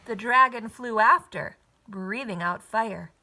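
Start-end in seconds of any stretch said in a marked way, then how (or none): none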